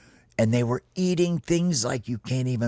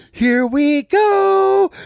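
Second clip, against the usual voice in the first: very high, loud, drawn-out squeal; slow, piercing rallying cry; excited critter yell; 8 dB louder, much slower than usual. Acted. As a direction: shout it as a tiny cartoon battle cry. Homie launches the chase.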